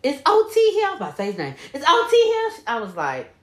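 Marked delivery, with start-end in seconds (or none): 0.0-0.9 high, polite asking voice. Retold or imitated. 1.7-2.6 high, polite asking voice. Retold or imitated. Repeated imitation of her.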